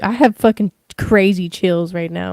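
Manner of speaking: ordinary talk, nothing marked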